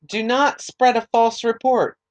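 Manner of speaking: measured, formal Bible reading; scripture reading. Acted. Reading Exodus aloud to listeners, measured scripture reading.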